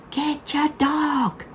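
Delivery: high, slow call across the beach; drawn-out yell to a distant dog owner. Retold or imitated. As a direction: stretch it out to reach someone down the beach. Calling to a man far off.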